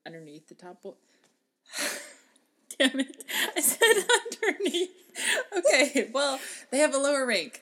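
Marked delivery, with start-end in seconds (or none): 1.8-4.4 breaking into giggles; laughing. Real, not acted. Laughing at own slip. 4.4-7.6 giggling, pitch up; laughing. Real, not acted. Laughing at own slip.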